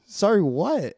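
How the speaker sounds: hammed-up alarm at the nurse's apology; alarmed repeat. Replaying her alarm at the nurse for a friend, loud and high.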